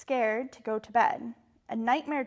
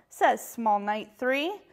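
usual speaking voice

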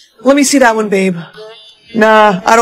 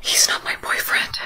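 hushed, voiceless confession; secret whisper; 12 dB quieter than usual. Acted. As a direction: whisper so he can't overhear. Secret plea to the saleswoman.

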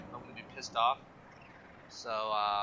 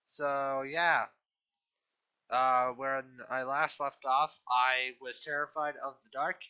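ordinary delivery

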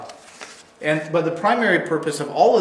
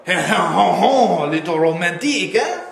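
very slow, drawn-out lovestruck sigh, louder; dreamy romantic; 2 semitones higher, 5 dB louder, much slower than usual. Pretend. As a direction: swoon over the words. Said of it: "you might come away feeling".

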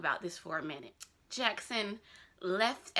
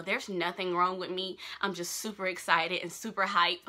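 usual speaking voice

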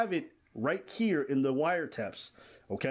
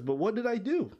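higher-pitched quoted plea; imitated victim's protest; 8 semitones higher than usual. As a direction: lightly voice a bewildered victim. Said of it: "And the victim says".

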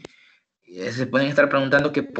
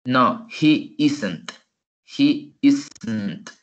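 very slow "he isn't" drill; slow model answer; much slower than usual. Shown as a demonstration. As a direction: repeat "he isn't" slowly. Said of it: "se pronuncia". (modelo lento de "he isn't")

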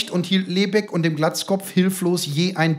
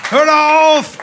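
high, loud counter-attack; angry yelled comeback; shouted retort; 9 semitones higher, 9 dB louder, slower than usual. A narrator's reading. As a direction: fire it back loudly at your rival. Other father retorts in shouting quarrel.

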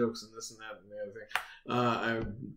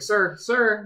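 mock calling after a customer, high and loud; raised, higher-pitched retold call; 9 semitones higher, 8 dB louder than usual. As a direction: imitate a lady trying to get someone's attention.

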